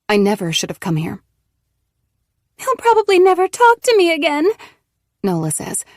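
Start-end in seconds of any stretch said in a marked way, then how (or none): 2.6-4.5 much higher, louder voice heavy with tears; tearful. Acted. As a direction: speak through tears about to spill. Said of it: "her voice heavy with tears".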